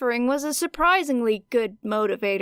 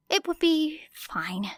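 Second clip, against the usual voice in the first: whisper; 6 semitones higher, slower than usual. A narrator's reading. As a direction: breathe it to yourself, barely voiced. Whispered repeat to herself.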